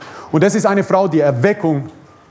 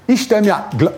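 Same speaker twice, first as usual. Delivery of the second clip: normal talking voice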